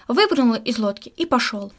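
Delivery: ordinary delivery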